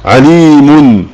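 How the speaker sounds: "عليم" said slowly as ʕalimun, the final -un clearly sounded; pronounced with tanween. Shown as a demonstration. Teacher demonstrates "عليم" with its tanween sounded, slowly. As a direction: stretch out "عليم" and let the nunation ring at the end. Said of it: "لما أكتب كلمة عليم".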